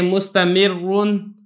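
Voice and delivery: मुस्तमिरुन read slowly with a full, heavy doubled र; slow model reading of मुस्तमिरुन with a heavy र. Shown as a demonstration. Teacher shows students a doubled र read full. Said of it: "पुर ही पढ़ा जाएगा".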